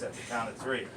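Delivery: normal talking voice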